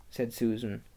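ordinary delivery

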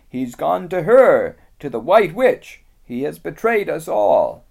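high, loud, grave beaver voice; a small creature delivering bad news; Mister Beaver.

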